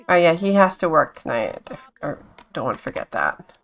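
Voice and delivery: ordinary talk, nothing marked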